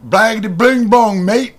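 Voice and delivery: garbled mock-Brit babble; mock English accent. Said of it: "'cause they're like".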